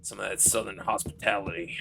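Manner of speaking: low, quiet Texan game-hero voice; Southern Snake. Acted. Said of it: "Southern Snake".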